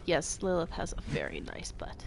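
soft whispered aside; whispering. Real, not acted. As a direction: hush it like a secret. Cheeky whispered agreement.

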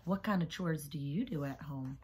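plain reading voice

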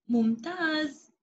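ordinary talk

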